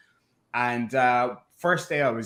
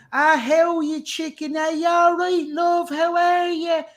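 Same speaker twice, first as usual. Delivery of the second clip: much higher, broad north Dublin lady's voice; north Dublin accent; 4 dB louder than usual. Retold or imitated. Mom's friend from north Dublin greeting him on the phone, in a broad north Dublin accent. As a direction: do a chirpy north-side Dublin auntie in a Dublin accent. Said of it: "she sounds like Conor McGregor, but a lady".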